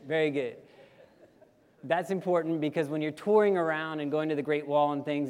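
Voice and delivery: ordinary talk, nothing marked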